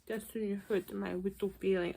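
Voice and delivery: soft mock hurt pout; mock sad. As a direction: whine it like a sulking child. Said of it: "mock Sean".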